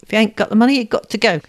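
mildly hammed-up rough bully. A narrator's reading. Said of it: "he repeated roughly".

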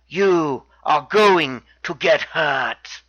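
very slow, low and deliberate threat; imitated warning. Wrestler warns his challenger, slow low imitated threat. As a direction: space out each word as a mock menace. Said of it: "and warns him".

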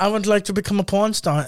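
mock German inmate; earnest answer in a put-on German accent; comic German-accented English. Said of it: "your German accent".

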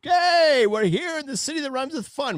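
high-pitched rock frontman greeting a crowd. Retold or imitated. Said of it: "[name] came out and he's like".

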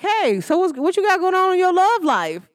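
mock the snoop asking about love life; nosy acquaintance. Nosy person prying about love life, laughing mock voice. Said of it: "I'm like".

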